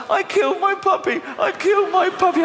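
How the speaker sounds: put-on high squealing child shout; wailing child. Children wailing to their uncle over the puppy, high shout. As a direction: howl it like a guilty kid. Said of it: "they start going".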